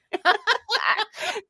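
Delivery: rising giggle, high up; high laugh. Real, not acted. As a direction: giggle up high at your own joke. Laughing after own joke.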